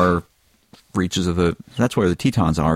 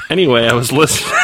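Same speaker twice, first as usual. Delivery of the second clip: laughing; 7 dB louder than usual. Real, not acted. Breaks into laughter reading.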